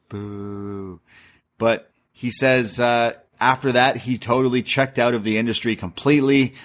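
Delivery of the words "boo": soft, drawn-out boo; mock booing. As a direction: boo quietly like a distant crowd. Mock crowd boo at the story.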